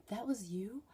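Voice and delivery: hushed whispered question. Retold or imitated. Classmate whispering back during class, whispered. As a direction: whisper it so the class can't hear. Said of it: "And she's like".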